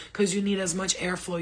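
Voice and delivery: ordinary talk, nothing marked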